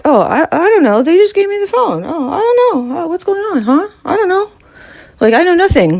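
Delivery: high, whiny mocking voice of a clueless guy; mock clueless nonchalance. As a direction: play dumb in a high whimpering mock-innocent voice. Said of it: "all nonchalant".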